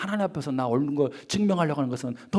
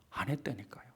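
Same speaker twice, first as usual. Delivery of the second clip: hushed insistence; 4 semitones lower, 14 dB quieter than usual. Real, not acted. Preacher to congregation, whispering the point. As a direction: drop to a near whisper, insisting.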